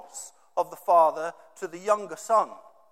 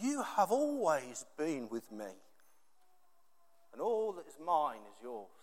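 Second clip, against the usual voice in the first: father's tender reply; 3 semitones lower, 9 dB quieter than usual. Retold or imitated. Father answers his bitter older son, tender and quiet. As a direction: gently reassure the resentful son. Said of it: "he said to him".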